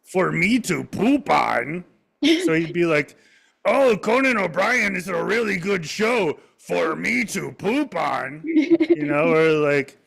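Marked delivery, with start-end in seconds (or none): 0.0-1.8 slow, high mock South American-ish accent; lightly hammed South American-ish puppet voice; Triumph impression. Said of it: "He would talk like this". 3.6-8.5 lightly hammed South American-ish puppet voice; Triumph impression. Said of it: "South American-ish accent".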